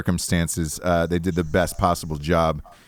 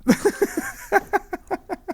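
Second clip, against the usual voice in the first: very high-pitched giggle; laughter; 3 dB quieter than usual. Real, not acted. Laughs after teasing the guest.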